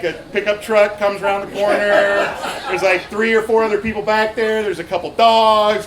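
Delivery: ordinary talk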